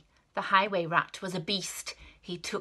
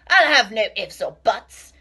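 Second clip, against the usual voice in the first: snarling rat; 3 semitones higher, 9 dB louder than usual. Acted. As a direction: growl it at the squirrel. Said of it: "snatched the sack and snarled".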